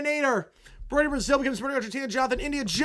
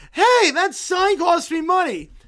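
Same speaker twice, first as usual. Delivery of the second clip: loud, higher protest; indignant yell; 7 semitones higher, 9 dB louder, slower than usual. Acted. Lucy yells at kids toppling her sign, indignant. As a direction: yell at the kids wrecking the booth.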